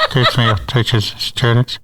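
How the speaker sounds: muffled PA announcement, laughing; over the intercom. Retold or imitated. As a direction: announce as if through a school PA. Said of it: "I'd talk like this".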